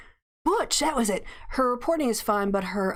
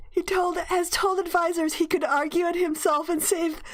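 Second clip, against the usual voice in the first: higher, slowing, laughter breaking in; laughing while reading; 7 semitones higher than usual. Real, not acted. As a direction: read it as the laugh takes over. Reading, cracking up.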